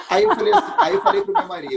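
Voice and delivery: high and loud, words breaking into laughter; can't stop laughing while telling it. Real, not acted. Laughing while retelling the win.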